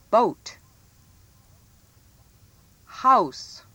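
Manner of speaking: ordinary delivery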